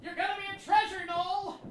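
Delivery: high, loud, over-bright encouragement; forced cheer. Acted. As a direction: pump him up despite doubts. Forced cheer to nervous actor.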